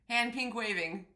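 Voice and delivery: usual speaking voice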